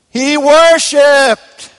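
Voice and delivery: soaring, loud and slow; climactic proclamation. Acted. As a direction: proclaim it high and drawn out like the sermon's peak. Sermon climax, key verb revealed.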